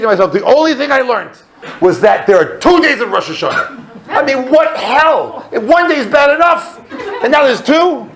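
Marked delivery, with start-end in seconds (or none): 4.1-8.1 high, exasperated comic rant; acted exasperation. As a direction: play up the indignant disbelief, pitched way up. Acts out outrage at second day.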